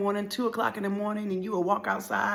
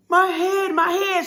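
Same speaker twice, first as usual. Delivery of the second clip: loud, high cry of pain; acted anguished yell; crying, screaming; 11 semitones higher, 7 dB louder, slower than usual. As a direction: scream it like a hurt child. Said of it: "crying, screaming".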